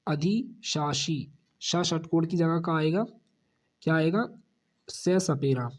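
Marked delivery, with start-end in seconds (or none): 0.1-1.3 अधिशासी drawn out slowly with a clear स; slow model word. Shown as a demonstration. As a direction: stretch out अधिशासी. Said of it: "यानी जो last में से सपेरा आएगा।". (अधिशासी बहुत धीरे बोलें)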